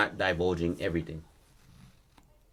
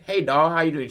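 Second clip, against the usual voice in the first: higher, louder chirpy waitress; 7 semitones higher, 5 dB louder than usual. Retold or imitated. Waitress greeting the boy at his table, high and friendly. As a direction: put on a diner waitress greeting.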